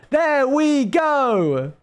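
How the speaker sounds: high, very slow drawn-out catchphrase; imitated AI dog. Retelling the dog's answer after a pause, high drawn-out impression. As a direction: stretch each word high and long. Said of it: "we go like".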